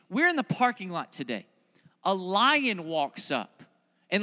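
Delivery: ordinary talk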